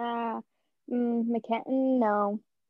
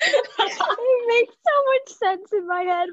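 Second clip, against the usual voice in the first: high, slow, breaking up with real laughter; laughing through words; 10 semitones higher, 3 dB louder, slower than usual. Real, not acted. Explaining own muddled thought to co-host, laughing helplessly.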